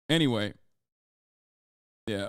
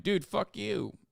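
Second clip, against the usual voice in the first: mock quote; 9 semitones higher, 4 dB quieter, slower than usual. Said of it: "running around being like".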